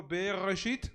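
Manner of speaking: Bereshit said out for repeating, guttural R; "Bereshit" with a throaty R, lifted at the end; model pronunciation. Shown as a demonstration. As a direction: present "Bereshit" loud and clear for the class. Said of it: "Digan conmigo".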